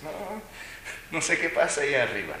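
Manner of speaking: voz de madre aguda y algo más fuerte, quitándose el problema de encima; madre desentendida. Retold or imitated. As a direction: como una madre que no quiere oír al niño. Madre que ignora al niño llorando.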